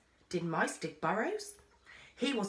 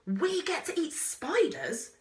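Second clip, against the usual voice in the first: slow, high, gleeful delight; sheer unholy joy; 9 semitones higher, slower than usual. A narrator's reading. Child thrilled at the idea of eating spiders, gleeful. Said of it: "sheer unholy joy".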